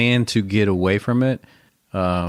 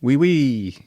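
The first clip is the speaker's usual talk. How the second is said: higher-pitched put-on French accent; mock French accent; 4 semitones higher than usual. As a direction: play at being French, hammed-up accent. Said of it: "a French accent".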